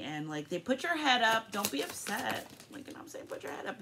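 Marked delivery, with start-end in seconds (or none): 2.7-3.8 voiceless whisper, much softer; whispered aside. Real, not acted. Reader whispering encouragement to the viewer. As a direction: lean in and whisper it.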